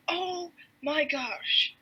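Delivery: very slow, drawn-out gasp of wonder; mock astonishment. Captain sees the ship through binoculars, mock astonishment. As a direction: stretch it out high, then let it fall. Voices Haddock seeing the ship.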